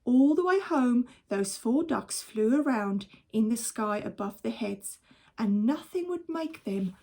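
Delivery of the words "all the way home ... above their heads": plain reading voice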